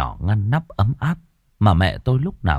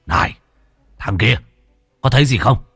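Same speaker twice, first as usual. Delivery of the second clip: man calling from outside; 3 semitones higher than usual. A narrator's reading. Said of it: "tiếng đàn ông từ ngoài vọng vào".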